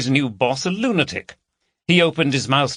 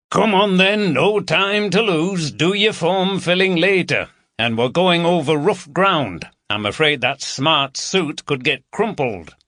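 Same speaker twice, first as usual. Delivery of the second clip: brisk Yorkshire inspector; 6 semitones higher than usual. A narrator's reading. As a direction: chivvy the new lad along cheerfully. Oldroyd voiced in the story.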